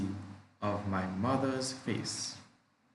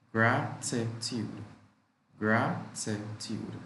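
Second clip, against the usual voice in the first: drawn out so learners hear every syllable; slow, syllable by syllable; slower than usual. Shown as a demonstration. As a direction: say it slowly, teaching each syllable. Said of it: "Three syllables".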